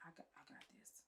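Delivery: a soft whispered reassurance; wife quietly answering the nurses, whispered. Retold or imitated. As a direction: breathe it, barely voiced.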